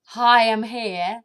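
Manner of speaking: a mock emotion saying hello. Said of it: "as it's saying".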